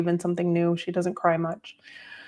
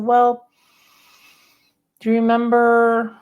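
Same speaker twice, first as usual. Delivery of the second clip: raised coaxing voice; 5 semitones higher, 6 dB louder than usual. Retold or imitated. Coaxing elderly mother, repeating.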